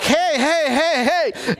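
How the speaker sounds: high-pitched mock alarm; hammed-up protest. Acted reaction to imagined cussing.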